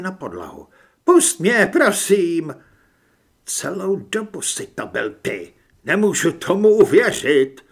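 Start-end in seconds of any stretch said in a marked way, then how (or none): 1.0-2.5 high, loud desperate plea; frightened pleading. Acted. 3.4-7.6 hurt, incredulous reproach rising to loud; pained disbelief. A narrator's reading. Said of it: "V jeho hlase zaznívala bolest a nevíra".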